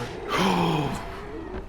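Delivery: long, soft sharp intake; startled gasp. Real, not acted. Gamer nearly spotted by a monster, gasping. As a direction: suck in breath in alarm.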